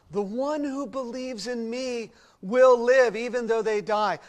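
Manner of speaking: solemn, ringing preacher's read; dramatic scripture reading. Acted. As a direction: read it out like a proclamation from the pulpit. Reads Jesus' words aloud.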